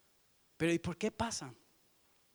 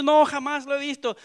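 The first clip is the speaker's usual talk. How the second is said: loud denial; 5 semitones higher, 8 dB louder than usual. Acted. Peter denying in fear.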